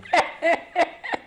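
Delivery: short high-pitched laugh. Real, not acted. Colleague laughs at teasing.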